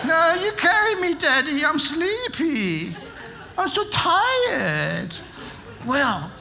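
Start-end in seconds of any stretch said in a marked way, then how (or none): 0.0-5.1 much higher, slow, drawn-out whining for Daddy; exaggerated whiny child. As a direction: overplay the sleepy kid begging. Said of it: "my kids used to always pretend to be asleep".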